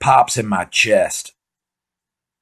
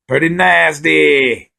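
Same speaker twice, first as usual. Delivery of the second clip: "Pretty nasty" in a high, slow put-on British drawl; hammed-up British accent; mock British; 5 semitones higher, 3 dB louder, much slower than usual.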